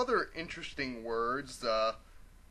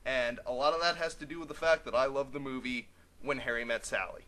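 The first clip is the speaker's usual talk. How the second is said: ordinary talk, nothing marked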